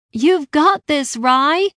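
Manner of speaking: high, loud, drawn-out cheer; shout. A narrator's reading. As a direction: shout encouragement to a friend. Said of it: "Ella shouted".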